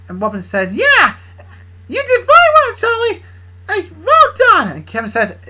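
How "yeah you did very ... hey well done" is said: very high, loud congratulation; high-voiced praise. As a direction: squeak out the praise.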